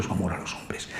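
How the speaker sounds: whispered, voice nearly gone; hushed whisper. Real, not acted. Preacher on Christ's love for people, whispered. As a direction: let the last words fall to a whisper.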